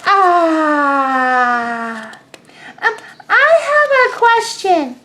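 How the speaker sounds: high, small duckling voice; a tiny curious duckling. Acted. Teacher voicing the duckling asking Pigeon, high and small.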